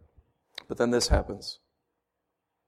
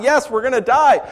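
higher, louder mock panic of Israel; mock panicked scream; 8 semitones higher, 6 dB louder than usual. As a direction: play the terrified crowd, hammed up. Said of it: "Israel is screaming out".

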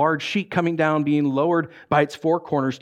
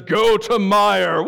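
slow, loud, commanding shout from above; booming angel voice; 3 semitones higher, 4 dB louder, slower than usual. Acted. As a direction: boom it down like a heavenly messenger.

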